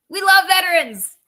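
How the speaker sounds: a high, loud mocking we love veterans; mock the councilors with a high sarcastic line. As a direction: sneer it out in a put-on voice.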